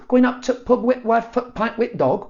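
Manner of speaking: clipped regional English with dropped words; mock northern pub talk; northern English imitation. Spanish teacher mocks northern English speech, high. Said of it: "sound like they're on a mobile phone".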